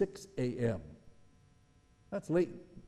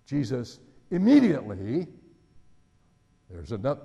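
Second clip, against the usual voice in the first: ordinary talk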